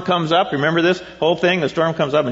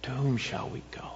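breathy whisper "To whom shall we go?"; whispered question; 3 semitones lower, 14 dB quieter than usual. Shown as a demonstration. Pastor leaning in to the congregation, whispering. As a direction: whisper it close to the listener. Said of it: "I want to say to you".

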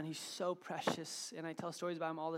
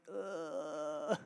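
long, drawn-out "ugh"; disgusted groan. Retold or imitated. Dad with sauce on his face, disgusted groan. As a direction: groan in mock disgust at the mess. Sauce all over him.

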